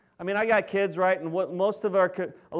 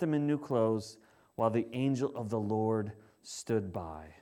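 quieter, measured reading of the Bible passage; scripture reading; 4 semitones lower, 3 dB quieter than usual. Acted. Pastor reads Zechariah to the congregation, quiet and measured.

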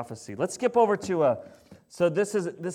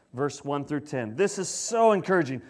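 ordinary talk, nothing marked

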